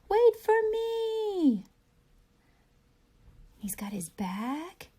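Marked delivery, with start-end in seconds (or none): 0.1-1.6 high, loud, slow calling out; mock yell. Teacher voicing Teddy calling after the boat, high and loud. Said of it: "he's yelling something".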